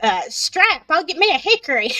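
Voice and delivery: mock-threatening Southern mama telling off a boy; Southern scolding. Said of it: "you're talking Southern right now".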